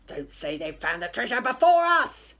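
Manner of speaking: loud pirate voice with a different timbre; a pirate fearing the treasure is gone; worried pirate. A narrator's reading. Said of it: "said Percy Patch".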